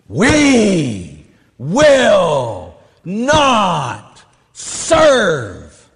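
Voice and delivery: slow, loud, drawn-out defiant declaration; nun pounding the podium. Retold or imitated. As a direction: hammer out each word like a rally chant. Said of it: "she's pounding on the podium".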